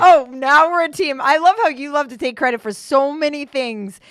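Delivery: loud, high mock indignation; mock indignant. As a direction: play up fake indignation. Mock outrage over credit.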